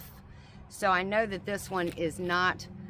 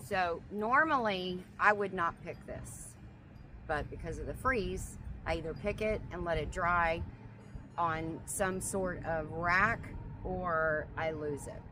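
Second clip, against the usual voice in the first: ordinary talk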